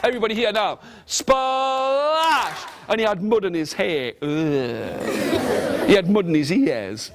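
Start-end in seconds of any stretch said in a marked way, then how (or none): 1.0-2.6 high, stretched-out "splash" with a burst at the end; playful sound-effect word; big splash noise. Acted. Imitating a great splash in mud. 4.2-5.9 low, drawn-out "ugh"; hammed-up noise of disgust; disgusted groan. Mud in his hair.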